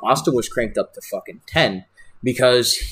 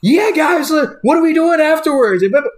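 a much higher, louder party-dude shout in a changed voice; Spicoli after the show; 5 dB louder than usual. Retold or imitated. Spicoli waking up after the show and shouting to the guys, high party shout. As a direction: shout it like the groggy friend suddenly full of energy. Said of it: "we'll get to a show and".

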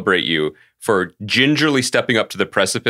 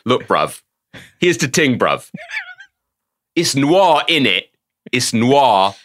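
imitating a London accent full of bruv and innit; Yann Demange London accent; slower than usual. Retelling a director pitching a red noir look, in a London accent. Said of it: "him being like".

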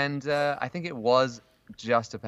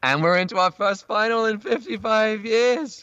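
high, slow, loud thrilled announcement; proud, elated cheer; excited; 14 semitones higher, 5 dB louder, slower than usual. Real, not acted. England reaching the final.

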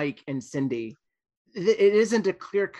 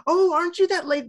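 high, louder questioning voice; mock recognizing fan; 5 dB louder than usual. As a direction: pipe up like a stranger spotting a celebrity. Mimics an excited stranger recognizing actress.

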